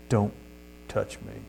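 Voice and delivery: low, soft, menacing warning; quiet threat. Retold or imitated. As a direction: warn him off quietly, in a low voice. Threat quoted from a killer.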